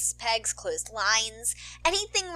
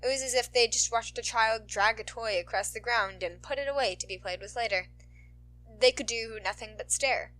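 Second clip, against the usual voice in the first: usual speaking voice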